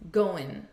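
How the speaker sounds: GOING said as "go-'n", AND shrunk to n; natural spoken linking, "go-'n"; reduced "go and". Shown as a demonstration. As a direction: run "go and" into one word, "go-in". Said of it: "this is going to sound like".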